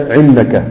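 عنك with a throaty ع for the hamza; Tamimi 'an'ana. Shown as a demonstration. Teacher showing Tamim dialect, hamza turned to ain. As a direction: say عنك starting with ع not أ. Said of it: "يجعلون الهمزة المبدوء بها عينا".

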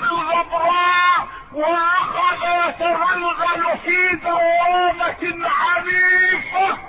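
high, ringing sermon cry; full-force preaching. Real, not acted. Preacher depicts the terrible fall for the congregation, impassioned high-pitched preaching.

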